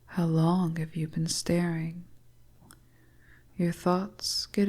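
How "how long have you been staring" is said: plain reading voice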